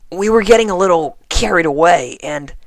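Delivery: high, embarrassed confession; sheepish tomboy voice. A narrator's reading. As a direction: own up with a guilty grin. Rainbow voiced higher.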